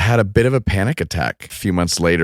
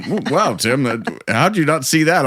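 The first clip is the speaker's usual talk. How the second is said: Marty imitation; 7 semitones higher than usual. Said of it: "he's walking around going".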